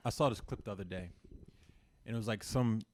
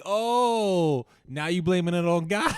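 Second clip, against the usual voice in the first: mocking loud retort; 5 semitones higher, 9 dB louder, slower than usual. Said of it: "he's like".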